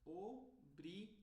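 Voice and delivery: slow "obrigado", separated syllables; every sound open. Shown as a demonstration. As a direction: spell out obrigado by syllable, wide vowels. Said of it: "para pronunciar todos os sons".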